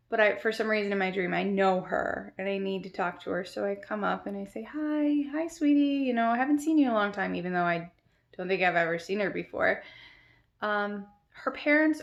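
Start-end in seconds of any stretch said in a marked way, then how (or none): 4.6-7.3 put-on higher-pitched, sweet hello to a little girl; sweet child greeting. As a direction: greet a small child with singsong affection.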